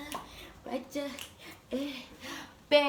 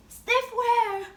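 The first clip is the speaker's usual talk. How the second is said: much higher and louder, thrilled; excited squeal; 12 semitones higher, 6 dB louder, much slower than usual. Real, not acted. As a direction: shriek it with delight. Excited shout on camera.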